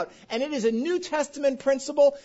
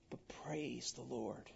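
quiet praise; 12 semitones lower, 21 dB quieter than usual. Acted. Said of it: "steadfast praise in hard times".

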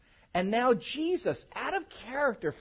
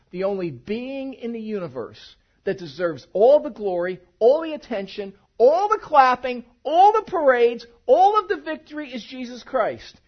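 building louder through the list of alls; stacking all the glory, all the parades, ever louder; preaching climax; 4 semitones higher, 9 dB louder than usual. Acted. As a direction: rise to a full preaching crescendo.

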